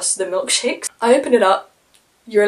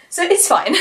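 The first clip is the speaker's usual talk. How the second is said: laughing; 10 semitones higher, 6 dB louder than usual. Real, not acted. Joking to viewers she can't audition, laughing. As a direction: say it through a high laugh.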